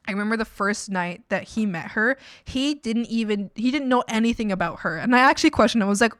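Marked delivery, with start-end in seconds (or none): none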